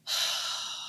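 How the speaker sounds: long audible soothing sigh; demonstrating a calming breath. Shown as a demonstration. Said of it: "I go".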